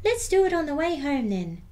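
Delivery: put-on bright and keen like a small child; little skeleton. Little skeleton planning the scare, high and keen. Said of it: "said the little skeleton".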